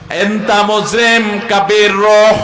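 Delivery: showing Gulf Arabic dialect slowly and loudly; regional Arabic. Shown as a demonstration. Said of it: "আরবি থেকে এসেছে, কিন্তু আঞ্চলিকতার ছোঁয়া".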